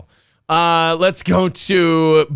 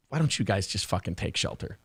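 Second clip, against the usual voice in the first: mock muttered aside; 8 semitones lower, 13 dB quieter than usual. Mocking the cops' pointless order.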